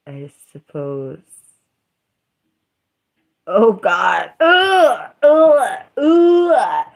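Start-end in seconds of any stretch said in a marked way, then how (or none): 4.4-6.9 high, loud groans of disgust. Real, not acted.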